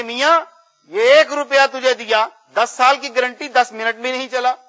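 put-on loud, high, indignant old customer; imitated angry complaint. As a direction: scold the seller as a cheated buyer. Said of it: "واپس آئی بڑے غصے میں". (بڑے غصے میں شکایت کی نقل)

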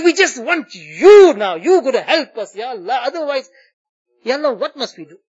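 ordinary talk

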